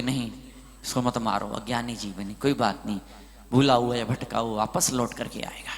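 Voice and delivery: low, soft, forgiving guru's voice; gentle forgiveness. Retold or imitated. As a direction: quietly reassure them, pitched well down. Voices guru forgiving his attacker.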